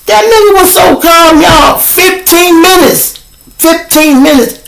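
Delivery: high, loud amazed shout, slow repeat. Real, not acted. Telling the audience how calm the driver was, loud high amazement. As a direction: drag out the disbelief.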